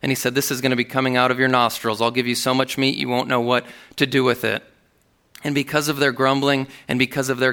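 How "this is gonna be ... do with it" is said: rapid retort. Acted. God voiced angrily, rapid.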